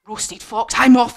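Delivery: sudden loud storybook exit cry; acted alarm, jumping in volume; alarmed fox. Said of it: "fox said".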